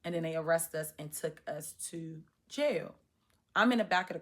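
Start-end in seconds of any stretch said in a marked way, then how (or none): none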